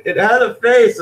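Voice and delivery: a lightly voiced preachy vegan; mock vegan whine. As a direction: parrot the vegan's complaint, sneering. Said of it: "when they say".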